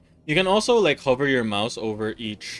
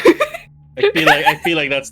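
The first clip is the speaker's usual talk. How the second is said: laughing; 6 semitones higher, 11 dB louder than usual. Real, not acted. Laughing at the anime voice idea.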